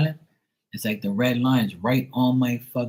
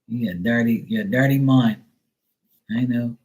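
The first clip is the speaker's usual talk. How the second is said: ordinary talk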